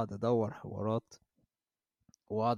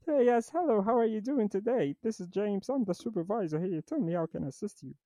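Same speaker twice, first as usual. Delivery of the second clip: higher, slow Texan supervisor voice; changed voice; 10 semitones higher, much slower than usual. Retold or imitated. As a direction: put on a Texas drawl as the boss. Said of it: "مغير صوتي ورحت داخل عليها".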